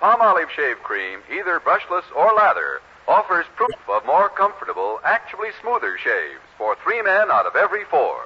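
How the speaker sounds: ordinary delivery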